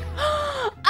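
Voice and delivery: big, high gasp; excited gasp. Real, not acted. Player seeing the test result, thrilled gasp. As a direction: gasp in sudden delight.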